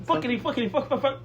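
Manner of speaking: put-on playful New York accent; mock New York cursing. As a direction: rattle the curses like a New Yorker. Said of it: "they're always cursing".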